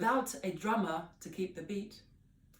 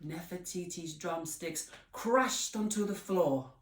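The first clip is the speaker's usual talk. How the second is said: plain reading voice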